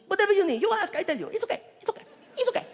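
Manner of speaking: put-on high, loud, eager foreign accent; happy to help, over and over; Saudi driver. Driver eagerly offers answers to the comic, high and loud.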